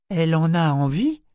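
high, loud baffled question; simple-minded astonishment; dumbfounded. A narrator's reading. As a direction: ask it bewildered, rising at the end. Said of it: "d'un air ahuri".

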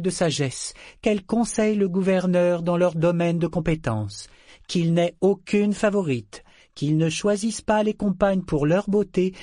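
solemn formal recitation; recited. A narrator's reading. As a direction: recite the code like a sacred text. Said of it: "Elle se redressa et récita".

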